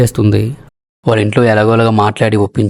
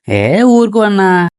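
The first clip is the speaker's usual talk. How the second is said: dismissive scoff; 7 semitones higher, much slower than usual. Acted. Dismissive brushing off of brother.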